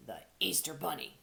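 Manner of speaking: put-on deep gruff old rabbit voice. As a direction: growl out the name. Rabbit names himself gruffly.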